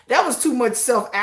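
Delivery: normal talking voice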